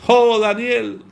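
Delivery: king calling into the lions' den, high and mournful; grieving king. Retold or imitated. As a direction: wail for Daniel like a grieving king. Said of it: "lo vamos a poner así como".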